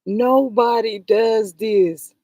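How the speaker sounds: each word slow and leaned on; slow emphatic. Real, not acted. Vlogger insisting nobody does this, slow and weighted. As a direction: spell it out, word by word, outraged.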